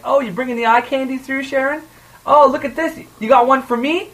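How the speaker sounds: high mocking catcall voice. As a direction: tease like a rowdy gym lady.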